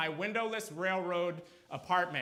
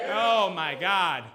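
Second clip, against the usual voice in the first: loud, slow exclamation; big, stretched-out reaction; emphatic exclamation; 8 dB louder, much slower than usual. Real, not acted. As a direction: draw it out loudly over the crowd. Reacting to the rant and crowd.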